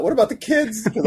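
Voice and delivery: high, plaintive mock plea; distraught. Writer repeating his first thought, mock distraught. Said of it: "And I was distraught".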